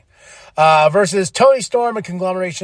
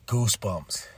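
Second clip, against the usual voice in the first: normal talking voice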